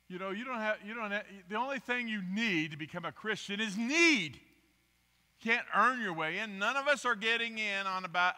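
preaching. Acted. Preacher pressing the point home to the congregation, loud and high.

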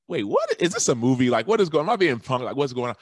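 retelling his own shock at a president walking in; stunned disbelief. Retold or imitated. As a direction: blurt it out like you can't believe your eyes. His shocked reaction on meeting Bush.

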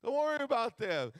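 a hint of the pastor's correction, high. Pastor telling him to mind himself, lightly voiced and high. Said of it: "But like".

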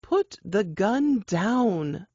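very slow, steady and gentle; calm firm request. Acted. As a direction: ease him into lowering it. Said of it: "the woman continued".

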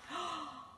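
high, soft startled gasp; shocked gasp. Acted. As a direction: gasp at what you see. Shock at mirror.

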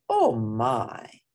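ordinary delivery

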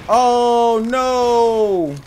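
loud, high "oh no"; alarmed. Real, not acted. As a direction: cry out in alarm. Enemy attacks.

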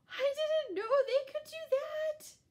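high, loud astonished voice; amazed kitten. A narrator's reading. Kitten stunned by the pig, high amazed squeak. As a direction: squeak it out in surprise.

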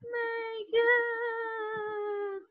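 long, high wailing gasp; acted terror. Scared [name] in the drama lesson, terrified. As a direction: draw it out in a high, frightened wail.

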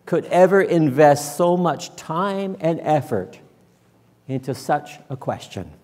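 ordinary delivery

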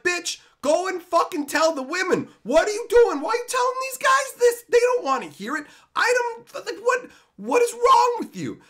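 high, loud exasperated shouting; retold yelling. Retelling yelling at coworker who bragged, loud high outrage. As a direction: yell at her in outrage, way up and loud. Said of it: "And I'm like".

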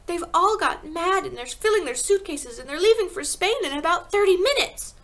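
high, loud, urgent rush of news; a child crying out the alarm; distressed cry. A narrator's reading. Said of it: "she cried".

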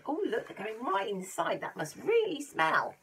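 plain reading voice

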